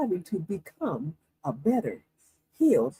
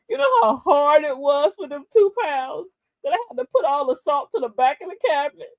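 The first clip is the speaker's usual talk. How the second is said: exasperated; 6 semitones higher, 4 dB louder than usual. Retold or imitated. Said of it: "I looked at her like".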